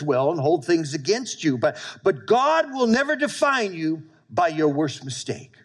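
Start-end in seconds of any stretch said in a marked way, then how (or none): none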